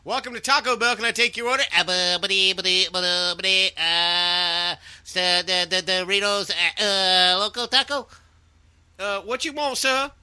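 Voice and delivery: high, loud stuttering cartoon pig; Porky Pig taking an order; Porky Pig at the drive-thru. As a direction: stammer like a flustered cartoon pig. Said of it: "No, it'd be more like this".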